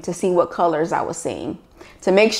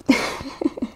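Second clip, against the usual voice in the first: breathy laugh; 7 semitones higher, 5 dB quieter than usual. Real, not acted. Laughs after the joke.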